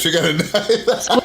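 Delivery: laughing alarm. Acted.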